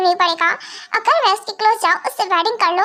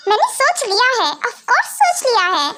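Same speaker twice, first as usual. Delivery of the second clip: usual speaking voice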